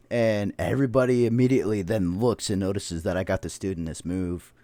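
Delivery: ordinary talk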